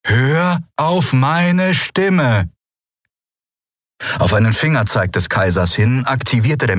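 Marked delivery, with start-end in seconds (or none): 0.0-2.5 very slow, high, drawn-out order; slow hypnotic command; commanding guide. Acted. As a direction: stretch each word insistently. Guide commands the panicking captain.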